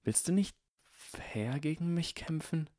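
a touch of wounded snobbery; hurt, condescending. A narrator's reading. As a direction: sound hurt but look down on him. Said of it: "klang verletzt und vielleicht ein wenig herablassend".

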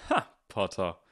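loud scoff at a rival. A narrator's reading. Draco scoffs at Harry before the crowd, loudly. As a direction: scoff it out for all to hear. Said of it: "sagte Draco laut".

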